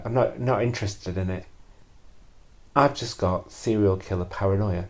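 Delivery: ordinary delivery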